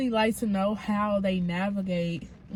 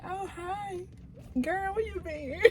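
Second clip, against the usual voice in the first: soft, high, sugary two-faced hello; fake friendly greeting; 10 semitones higher, 8 dB quieter than usual. Gossipers greeting the person they talked about, fake-friendly. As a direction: coo it like you weren't just gossiping. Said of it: "we talk mess, and as soon as they come up".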